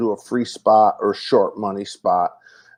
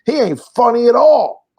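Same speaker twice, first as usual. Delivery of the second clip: mock-indignant, pitched up high and pushed louder; scoffing dismissal; 12 semitones higher, 5 dB louder than usual. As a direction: scoff it like he can't believe anyone laughed. Friend dismissing the comic.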